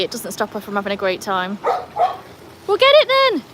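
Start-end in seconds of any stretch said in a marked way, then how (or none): 1.6-3.4 loud, high shout to a dog; playful high-pitched holler; calling out. Real, not acted. Urging dog after thrown thing.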